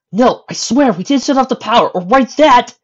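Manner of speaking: put-on high, loud boy's denial; Dipper's voice. A narrator's reading. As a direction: swear innocence in a high, urgent kid's voice. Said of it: "said Dipper".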